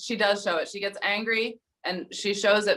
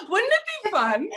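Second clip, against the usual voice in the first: much higher, laughing, sing-song "Wouldn't it be fun?"; Monica. Retold or imitated. Friend imitating Monica's catchphrase for the group, mock cheerful. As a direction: chirp it like an upbeat friend. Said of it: "what was it Monica was always saying".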